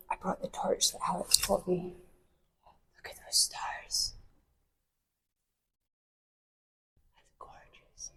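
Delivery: hushed whisper in the dark. Real, not acted. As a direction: whisper in awe. Inside dark quiet crypt.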